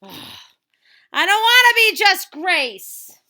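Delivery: high, slow, loud whining sigh; drawn-out sulky wail, loud and slow; whiny sigh. Acted. Girl sighs about her name.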